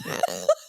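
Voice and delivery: loud half laugh, half sob; laugh-cry burst. Real, not acted. Laughing and crying at once over the chaos, with a loud laugh-sob. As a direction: burst out laughing through tears.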